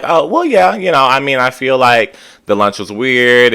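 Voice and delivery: lightly voiced friend, hedging; imitating Jess. Recapping a reality show, voicing Jess's awkward answer, hesitant.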